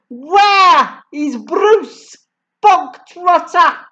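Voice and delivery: high, loud and slow demand; bellowing. A narrator's reading. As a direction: roar it across the hall. Headmistress bellows for the boy.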